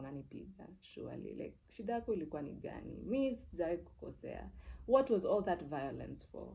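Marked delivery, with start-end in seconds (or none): none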